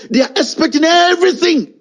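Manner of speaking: EVERYTHING pitched high and stretched; exasperated emphasis. Real, not acted. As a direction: hammer the word everything in exasperation. Ranting at so-called intellectuals.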